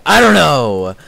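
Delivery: exasperated outburst. Real, not acted. Streamer baffled by own habit of saying okay, exasperated. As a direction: burst out loudly, voice jumping up.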